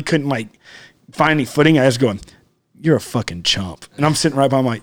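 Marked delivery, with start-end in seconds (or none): 2.8-3.8 low, soft and slow under the breath; muttered insult. Retold or imitated. Sitting beside the driver, quietly muttering the insult. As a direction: say it half to yourself.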